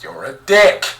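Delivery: slow, loud jibe; joking yell, drawn out; mock outburst. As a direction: break out of narration into a slow, loud insult. Mock outburst interrupting the narration.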